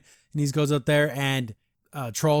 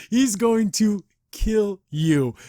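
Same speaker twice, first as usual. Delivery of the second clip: slow, louder, higher mock threat; mock menacing threat; 6 semitones higher, 4 dB louder, much slower than usual. Imitating Shadow Son threatening to kill somebody over his daughter, mock menace. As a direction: draw out a hammed-up deadly warning. Voices an angry father's threat.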